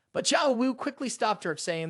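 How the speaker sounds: ordinary delivery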